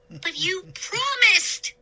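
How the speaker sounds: high, pleading protest; desperate and betrayed; upset cry. A narrator's reading. Younger brother crying out that the pirate promised, high pleading. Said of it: "Sean cried".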